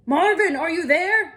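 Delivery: high, loud call into the fog; shouting for Marvin in the fog. Acted. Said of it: "Mister Zonker shouted".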